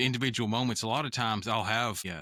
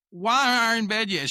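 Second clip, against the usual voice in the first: mock upset kid, pitched higher; upset daughter; 10 semitones higher, 5 dB louder than usual. Dad imitating his daughter upset at bedtime, higher and louder. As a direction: whine it like a tired kid who just noticed the time. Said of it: "She would just get super upset".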